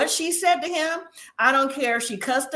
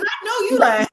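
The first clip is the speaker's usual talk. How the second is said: high incredulous call-out breaking into laughter; amused disbelief; 10 semitones higher, 4 dB louder than usual. Retold or imitated. Co-host echoing her own reaction, amused disbelief.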